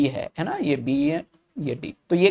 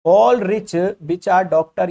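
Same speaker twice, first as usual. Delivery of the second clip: slow dictation; 3 semitones higher, 4 dB louder, much slower than usual. Shown as a demonstration. Teacher reading the statement slowly.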